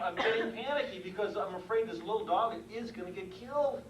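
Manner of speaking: ordinary talk, nothing marked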